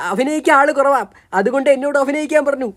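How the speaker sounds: ordinary talk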